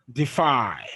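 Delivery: light "de", stressed falling "fy" in Defy; stress on second syllable. Shown as a demonstration. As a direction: Defy with the weight on "fy". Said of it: "the second".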